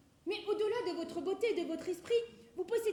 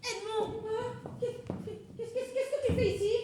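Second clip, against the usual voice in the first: startled, stammering, rising higher; flustered surprise; 5 semitones higher than usual. Acted. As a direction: caught out, stumbling over words. Caught in the act, flustered.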